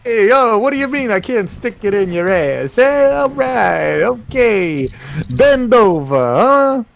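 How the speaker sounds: high, hammed-up mock Italian accent, stretching the hey; bad Italian voice. Joking with co-host in a goofy mock Italian accent. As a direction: keep up the cartoon Italian bit. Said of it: "the worst Italian voice".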